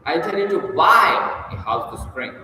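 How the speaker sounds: BUY punched high and loud; stress on BUY. Shown as a demonstration. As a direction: hit the word "buy" hard. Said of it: "putting the emphasis on different words".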